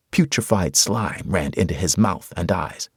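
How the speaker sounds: ordinary delivery, nothing marked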